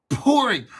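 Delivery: posh English "Pouring", loud and high; English accent. Shown as a demonstration. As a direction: say "Pouring" like a Brit. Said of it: "That's my English accent".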